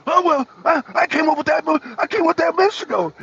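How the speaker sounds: high, fast mock old-man whine; lightly mocking Biden taking credit. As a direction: squeak out the boast like a flustered old man. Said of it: "He's like".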